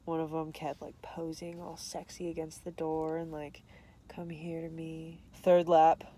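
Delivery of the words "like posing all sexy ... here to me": whispered sexy come-on; mock seductive whisper; sultry whisper. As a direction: breathe it like a tease.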